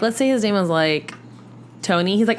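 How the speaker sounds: ordinary talk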